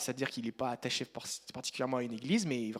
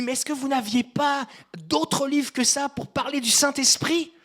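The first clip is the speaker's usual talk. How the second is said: higher, slow, louder outcry; shouting in the dream; 10 semitones higher, 8 dB louder, slower than usual. Retold or imitated. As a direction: shout it across the hall, protesting. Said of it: "je me lève et je hurle, je crie".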